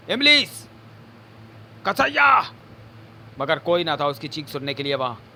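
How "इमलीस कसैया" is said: full-throated scream, much higher and louder; screaming names. Acted. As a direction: scream for help at the top of your lungs. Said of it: "हलक फाड़ चीखा झंड". (गला फाड़कर चीखना)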